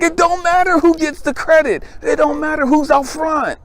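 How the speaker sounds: high, indignant challenge; exasperated retort. Retold or imitated. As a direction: call her out on her ego. Retold argument with a friend.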